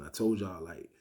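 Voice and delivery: ordinary delivery, nothing marked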